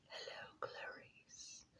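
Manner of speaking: slow, breathy whisper, very soft; eerie whispered greeting from behind a mask; creepy movie villain. Pretend. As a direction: hiss it slowly like a menacing villain. Creepy movie line while wearing mask.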